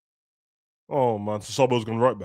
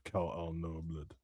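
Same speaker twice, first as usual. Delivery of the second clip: mock dying whisper; 7 semitones lower, 16 dB quieter, much slower than usual. Mock her line, dying.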